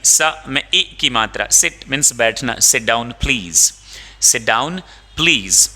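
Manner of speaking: ordinary talk, nothing marked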